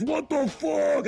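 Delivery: high, slow cry of shock; imitated alarmed wife. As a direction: demand it as a horrified wife, drawn out.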